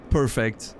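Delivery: normal talking voice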